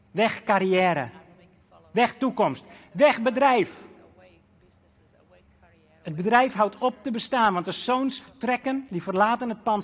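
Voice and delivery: loud, high fervent preaching; preaching at full force. Acted. Preacher driving home the sons leaving their father, fervent and loud. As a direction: hammer each phrase home.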